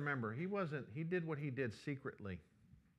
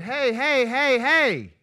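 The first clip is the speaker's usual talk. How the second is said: loud, high hey to stop a fight; calling out; 11 semitones higher, 16 dB louder, much slower than usual. Retold or imitated. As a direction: shout them apart. Breaking up a kids' fight.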